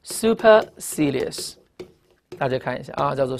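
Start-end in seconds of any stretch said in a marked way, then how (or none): 0.0-1.5 "supercilious" drawn out very slowly, higher, every syllable clear; "supercilious" very slowly, pitched up; slow model reading. Shown as a demonstration. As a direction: stretch out "supercilious" for the class to copy.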